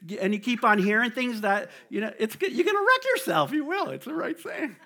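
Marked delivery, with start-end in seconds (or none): 2.2-4.8 high, laughing emphasis; excited laughing. Real, not acted. Preacher gets carried away, laughing and excited. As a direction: push it up with a laugh.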